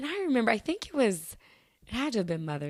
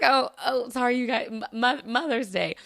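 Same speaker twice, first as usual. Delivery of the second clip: louder, stumbling self-correction; flustered apology; 5 semitones higher, 5 dB louder than usual. Retold or imitated. Choir director to congregation after wrong holiday, flustered. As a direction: stammer out an embarrassed correction.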